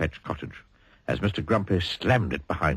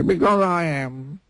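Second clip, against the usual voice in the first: slow sulky reply; 9 semitones higher, slower than usual. Acted. Mister Miserable sulking.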